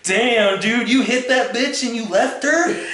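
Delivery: mock shocked bro reaction; incredulous buddy. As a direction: play the stunned bro. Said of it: "his reaction's like".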